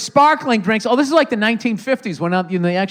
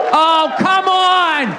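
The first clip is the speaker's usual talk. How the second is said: higher, louder shout to rouse the crowd; rallying shout; 10 semitones higher, 3 dB louder than usual. Real, not acted. Comedian hypes the audience for louder cheers, shouting. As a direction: whip the crowd up, yelling. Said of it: "who here's excited".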